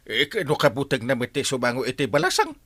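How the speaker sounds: ordinary delivery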